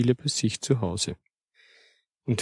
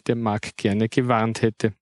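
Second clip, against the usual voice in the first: plain reading voice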